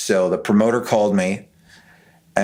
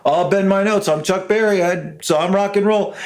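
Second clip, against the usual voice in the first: much higher, brash and swaggering star voice; 9 semitones higher than usual. Retold or imitated. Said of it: "that's exactly how he said it".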